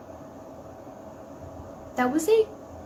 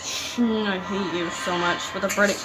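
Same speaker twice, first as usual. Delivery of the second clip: ordinary talk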